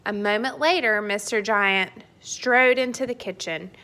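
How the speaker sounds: plain reading voice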